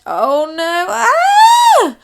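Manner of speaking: high, loud shriek of shock; screaming maid. Acted. Maid finding the orangutan in bed. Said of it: "screamed the maid".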